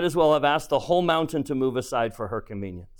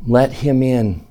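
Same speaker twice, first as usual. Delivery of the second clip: slow plea; 4 dB louder, slower than usual. Real, not acted. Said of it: "preacher pleads".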